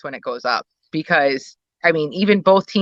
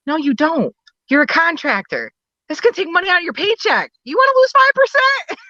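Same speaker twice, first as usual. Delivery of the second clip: high-pitched exasperated imitation, climbing higher; mock arguing; 11 semitones higher than usual. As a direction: argue back incredulously, rising to a squeal. Daniel arguing back, retold banter.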